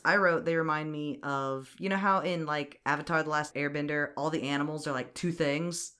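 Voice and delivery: normal talking voice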